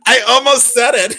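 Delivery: high, loud words through laughter; laughing hard. Real, not acted. Admitting a near slip to Becky, laughing hard. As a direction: squeal it out while cracking up.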